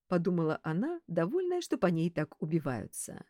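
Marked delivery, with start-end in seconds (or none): none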